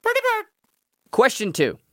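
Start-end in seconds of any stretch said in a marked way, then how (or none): 0.0-0.5 high squawky parrot voice. Pretend. Imitating a talking parrot.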